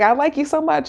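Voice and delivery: lightly voiced lovestruck man; gushing. Matt telling Serena, high eager mock gush.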